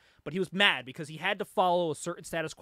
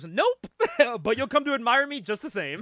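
cocky [name] voice; 4 semitones higher, 7 dB louder than usual. Retold or imitated. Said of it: "And [name] goes".

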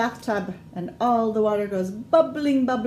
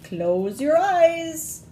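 warning; 7 semitones higher, 5 dB louder, much slower than usual. Acted. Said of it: "warns the pirate mommy".

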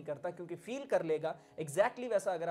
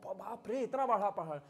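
mildly awed exclamation; wonder; 2 semitones higher than usual. Shown as a demonstration. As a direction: marvel at something huge. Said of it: "आप wonder कर रहे हैं". (हल्का अचरज, विस्मय से)

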